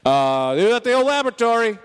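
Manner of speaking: quick and high, announcing the arrival; sudden excited announcer lift; excited announcement. Acted. Game master announcing arrival.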